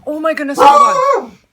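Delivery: sudden high, loud alarm; startled exclamation. Real, not acted. Cat causes chaos in house.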